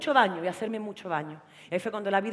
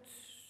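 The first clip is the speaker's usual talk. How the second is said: hush; 28 dB quieter than usual. Retold or imitated. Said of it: "me decía".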